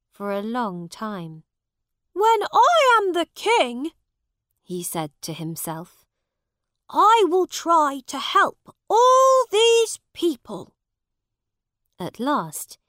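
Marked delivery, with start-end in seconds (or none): 2.1-3.9 1 determined vow. A narrator's reading. Prince vows to himself. 6.9-10.7 1 determined vow. A narrator's reading. Prince vows to himself.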